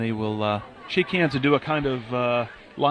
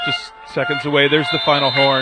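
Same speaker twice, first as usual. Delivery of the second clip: play-by-play; 4 semitones higher, 7 dB louder than usual. Real, not acted. Calls the final horn.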